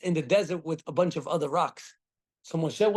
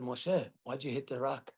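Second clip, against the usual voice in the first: softer, low disappointed question; quiet rebuke; 3 semitones lower, 8 dB quieter than usual. Retold or imitated. God quietly rebuking Moshe over the rock, soft and grave. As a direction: ask it gently but gravely.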